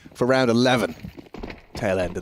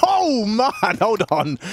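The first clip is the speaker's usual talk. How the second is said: much higher, laughing burst of surprise; excited laughing exclamation; 8 semitones higher, much slower than usual. Real, not acted. Caster laughing at a wild play, excited outburst. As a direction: crack up at the play, pitch leaping.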